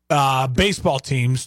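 normal talking voice

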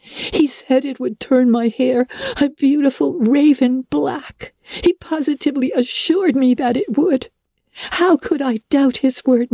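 put-on high, earnest girl's voice; girl telling how she was deceived. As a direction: plead her case. Anne explains the peddler.

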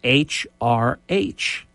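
normal talking voice

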